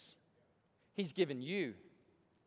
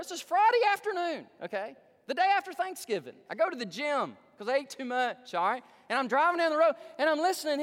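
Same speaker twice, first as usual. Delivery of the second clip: loud, higher pitched fervent storytelling; preaching at full force; 9 semitones higher, 8 dB louder than usual. Acted. Preacher retelling his drive to the congregation, fervent. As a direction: build it up with revival fire.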